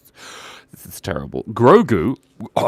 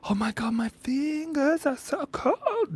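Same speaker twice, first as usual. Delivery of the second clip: mock whine; 4 dB quieter, slower than usual. Streamer whines about cold fingers.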